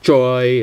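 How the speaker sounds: "joy" with a full English j; wrong, English-style "joy". Shown as a demonstration. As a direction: round the lips on the j of "joy". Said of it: "it's not joy".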